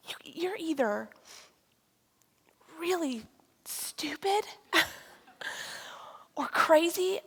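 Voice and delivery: hushed, slow, half-laughing whisper; incredulous whisper. Retold or imitated. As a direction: mutter it under your breath in disbelief. Her inner disbelief, whispered.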